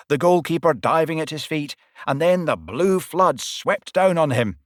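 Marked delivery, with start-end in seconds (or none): none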